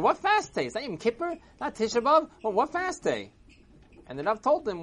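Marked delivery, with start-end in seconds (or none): 0.0-3.3 high-pitched baffled questioning; incredulous. Acted. Old man hears of fast day, incredulous. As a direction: fire back questions in disbelief.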